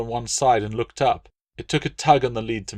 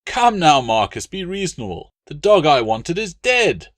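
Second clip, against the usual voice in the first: high, loud aggrieved noble; pompous Roman legate, put out; indignant legate; 7 semitones higher, 6 dB louder than usual. Acted. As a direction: protest like a spoiled patron. Latius placates then complains.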